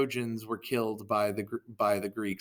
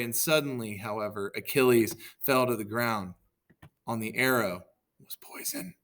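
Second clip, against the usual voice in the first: usual speaking voice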